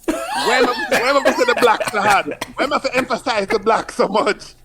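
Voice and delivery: high, worried mother's questions with laughs; lightly voiced, high and laughing; fretting mother. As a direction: fuss like an anxious Jamaican mother.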